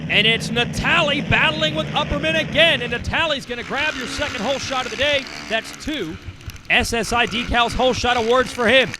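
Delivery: excited live race call; motorsport commentator. Acted. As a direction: call the battle for the lead.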